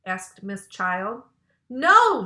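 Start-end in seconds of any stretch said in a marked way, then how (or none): 1.7-2.2 high, loud shouted no. A narrator's reading. Girls refusing to touch the snake, a loud high shout. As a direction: yell the refusal. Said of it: "shouted the girls".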